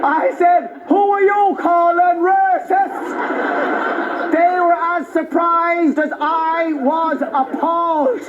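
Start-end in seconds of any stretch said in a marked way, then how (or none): 0.9-4.3 put-on slow, drawn-out indignant Traveller accent; imitated Traveller accent. Comedian answers Travellers back in their accent, slow and indignant. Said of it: "answered them in their accent".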